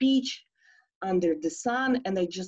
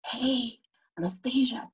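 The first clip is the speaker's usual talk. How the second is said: unvoiced whisper, a little higher; 5 semitones higher than usual. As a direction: whisper so no one else wakes. Waking friend at night.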